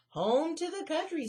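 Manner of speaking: high, loud squeak. Acted. Annie squeaking her answer while running. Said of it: "squeaked Annie".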